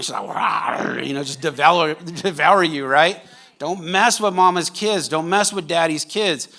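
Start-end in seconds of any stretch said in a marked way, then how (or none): 0.3-1.1 playful mock lion's growl; mama lion roar. Preacher imitating a mama lion defending her kids, mock roar. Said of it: "We're talking mama bear, mama lion".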